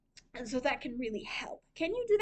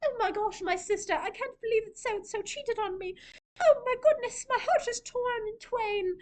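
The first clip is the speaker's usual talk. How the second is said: high, over-the-top swooning lament; melodramatic heartbroken sister; 10 semitones higher, 4 dB louder than usual. Retold or imitated. Heartbroken drama heroine pouring out her woes to her sister, high and swooning. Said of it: "then they can just say, like".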